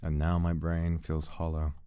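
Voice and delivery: lightly voiced grim narrator; dramatic monologue. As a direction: narrate like a gothic tale. Dramatic narration over building.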